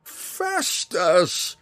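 high wild shout of the name; a zealot howling in rapture; wild cry. A narrator's reading. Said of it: "Jenkin cried wildly".

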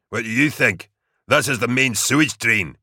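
put-on irritable older soldier snapping back; snapped retort. A narrator's reading. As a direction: snap it at a foolish question. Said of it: "Broad snapped".

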